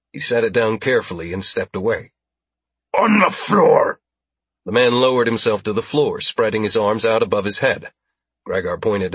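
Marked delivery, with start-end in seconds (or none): 3.0-4.0 slow, high, loud alien shout; drawn-out, forceful alien command; alien's order. Acted. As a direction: bellow it as an alien captor.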